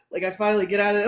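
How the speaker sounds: usual speaking voice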